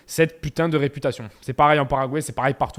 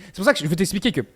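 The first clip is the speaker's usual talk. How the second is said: ordinary talk, nothing marked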